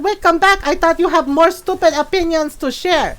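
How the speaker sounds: loud, high challenge in mom's voice; hammed-up scolding mother impression; imitated Filipino mom. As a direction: call them back like a feisty mom. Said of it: "Filipino lady".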